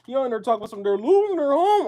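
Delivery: hammed-up high wail about losing homes; mock panicked Doomer. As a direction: whine it like a panicking doomsayer.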